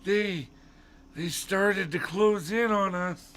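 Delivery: high, slow put-on voice; high, drawling old man; Mister Dawes. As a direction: stretch it out in a high character voice. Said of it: "you're not doing the voice".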